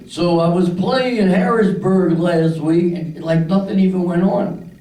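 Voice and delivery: a hint of Bill Cosby; lightly voiced rambling comic; Cosby impression. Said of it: "This is the way the guy was".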